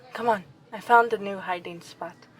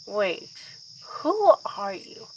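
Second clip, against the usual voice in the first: a guarded first who are you to the stranger; puzzled question; slower than usual. Acted. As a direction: ask it startled, taking stock. Confronts a stranger.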